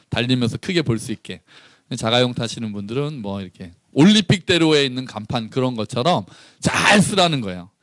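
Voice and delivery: ordinary delivery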